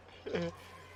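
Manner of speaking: short bursts of real laughter. Real, not acted. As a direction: laugh it off mid-story. Laughing between retold quotes.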